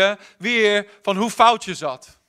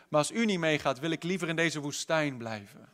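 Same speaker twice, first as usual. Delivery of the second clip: quiet heartfelt plea from Moses; hushed plea; 3 semitones lower, 8 dB quieter than usual. Acted. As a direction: drop to a soft, low plea. Moses restates plea, intimate.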